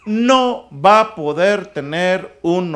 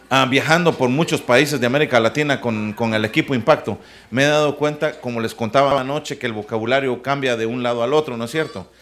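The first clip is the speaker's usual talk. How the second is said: normal talking voice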